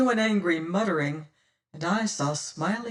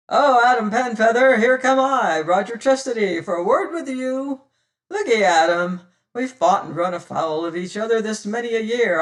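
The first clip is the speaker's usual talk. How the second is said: loud, higher cheery hail; 3 semitones higher, 6 dB louder than usual. Acted. Tressady hailing his old enemy Penfeather, loud and cheery. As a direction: call out across the water, long and cheerily. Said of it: "hailed long and cheerily".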